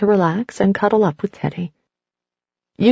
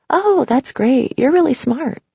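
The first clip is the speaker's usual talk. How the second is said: little voice; 6 semitones higher than usual. A narrator's reading. Teddy's little voice, slow.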